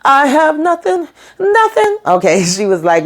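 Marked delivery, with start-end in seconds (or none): 0.0-2.0 much higher, keening wail on nothing; theatrical high-pitched despair; mock wail. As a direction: wail it high like a hammed-up lament. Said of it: "she says".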